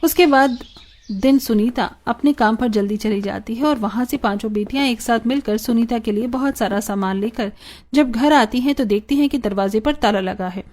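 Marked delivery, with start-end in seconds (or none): none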